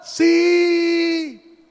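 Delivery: high, long drawn-out yes; acted far-off yell; shouted reply. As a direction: holler back across a mountain. Climber shouts back to God.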